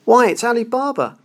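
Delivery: high, delighted cry of recognition; ecstatic exclamation. Acted. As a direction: burst out with childlike joy. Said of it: "Scrooge exclaimed in ecstasy".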